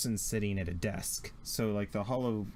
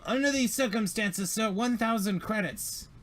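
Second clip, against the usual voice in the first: high, sly haggling voice; name the price like a smug trader; greedy alien; 10 semitones higher than usual. Acted. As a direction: alien trader naming his price to the crew.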